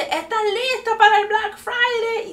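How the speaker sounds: high, drawn-out announcer call; rally the shoppers over a loudspeaker; imitating a store announcer. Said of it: "se escuchan por parlante".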